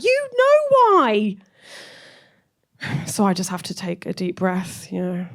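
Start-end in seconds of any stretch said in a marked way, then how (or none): 0.0-1.4 high, slow drawn-out knowing line; slow high knowing reply; knowing mum. Retold or imitated. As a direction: stretch it out pointedly. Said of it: "And she's like".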